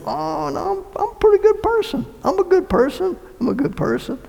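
mock the smug self-righteous; imitating the self-righteous. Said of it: "the self-righteous go".

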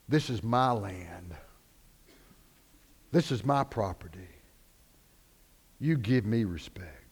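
low, quiet, measured authority; solemn voice of God. Acted. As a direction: speak low and softly, with quiet command. God's words to Moses, acted.

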